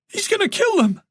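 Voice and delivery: very high, frightened cry; terrified for loved ones; panicked. A narrator's reading. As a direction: blurt it out in rising panic. Fears for her parents' lives.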